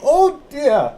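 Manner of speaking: put-on a little old lady straining to hear; deaf old lady.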